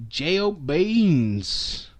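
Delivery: slow, stretched spelling of J O Beans; drawn-out pun. Acted. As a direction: drag out each letter of J O Beans.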